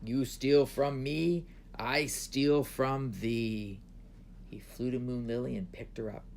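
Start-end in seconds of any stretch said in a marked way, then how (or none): 0.0-3.8 loud, slow pronouncement; dragon's threat. A narrator's reading. Dragon taking revenge on the emperor, slow loud threat. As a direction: boom it out slowly, every word weighted.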